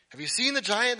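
high, loud and eager; excited soldier gossip. Acted. Frightened army men asking each other about the giant, excited. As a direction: pass on astonishing news.

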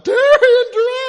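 full-blast high shout of a name; yelling out. Acted. Fan spotting the quarterback in a restaurant, yelling. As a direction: holler it across the room.

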